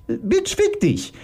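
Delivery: high, drawn-out venomous insult. Acted. Iris insulting her opponent, venomous. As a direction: slowly spit the insult up high. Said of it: "spie Iris giftig".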